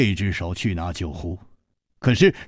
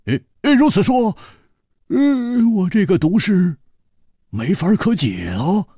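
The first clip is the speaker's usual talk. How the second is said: higher, louder stammering friend in alarm; wide-eyed alarm; 11 semitones higher, 5 dB louder than usual. Acted. Hu Tiehua to the couple, alarmed stammer, higher. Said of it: "胡铁花瞪了瞪眼睛说".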